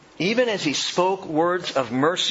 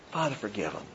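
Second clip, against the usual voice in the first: soft plea; 7 semitones lower, 10 dB quieter, faster than usual. Acted. Quoting Jesus softly.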